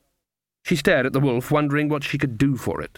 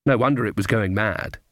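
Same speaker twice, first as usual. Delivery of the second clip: ordinary delivery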